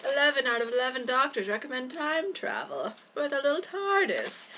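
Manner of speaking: mock commercial pitch, rising on tardis; ad slogan voice. Reads poster slogan in put-on voice.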